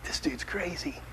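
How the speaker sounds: whispered aside; hushed whispered judgment. Retold or imitated. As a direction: whisper it like a private thought. Imagined host thinking privately.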